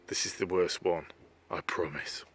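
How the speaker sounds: soft, then whispered; whispered aside. Real, not acted. Narrator apologising to listeners for the pun. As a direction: confide it under your breath.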